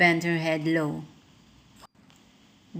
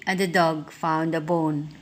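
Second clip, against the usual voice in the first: usual speaking voice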